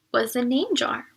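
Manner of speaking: ordinary delivery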